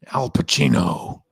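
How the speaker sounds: slow American-accent impression. Shown as a demonstration. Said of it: "That's my American accent".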